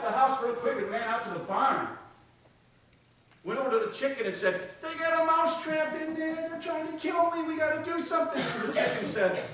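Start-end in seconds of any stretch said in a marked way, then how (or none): 4.8-8.4 very high squeaky mouse voice, shouting in panic; a tiny mouse begging for its life; frantic mouse. Acted. Mouse pleads with chicken for help.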